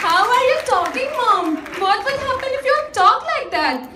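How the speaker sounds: high, mimicking singsong of a complaint; mocking echo. Mother parrots son's complaint back.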